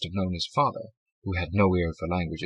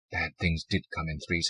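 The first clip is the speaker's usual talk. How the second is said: usual speaking voice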